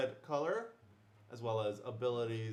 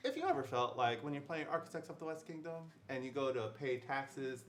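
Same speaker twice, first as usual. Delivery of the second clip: ordinary talk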